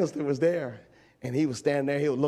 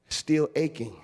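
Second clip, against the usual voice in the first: ordinary talk, nothing marked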